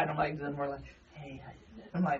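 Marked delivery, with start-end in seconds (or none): 1.1-1.9 very soft, low come-on; attempted sexy voice. Shown as a demonstration. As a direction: try a sultry murmur, not quite pulling it off. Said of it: "I need a sexy voice".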